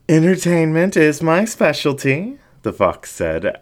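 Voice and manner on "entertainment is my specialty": high, lewd showman's boast; smutty. Acted. Fox flirts with the dingo, high and smutty. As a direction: make it as dirty as you can. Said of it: "as smutly as possible".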